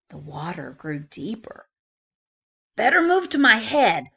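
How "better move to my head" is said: shaggy dog voice. Acted. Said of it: "said the shaggy dog".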